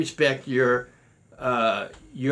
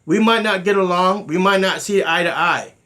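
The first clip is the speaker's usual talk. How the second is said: louder and higher, pressing the point; emphatic loud; 6 semitones higher, 6 dB louder than usual. Real, not acted. Grandmaster insisting to listeners, loud and emphatic. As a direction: raise the voice on might not. Stressing loyalty despite disagreement.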